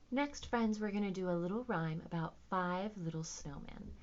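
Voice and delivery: ordinary delivery, nothing marked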